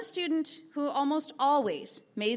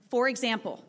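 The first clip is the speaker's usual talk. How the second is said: ordinary talk, nothing marked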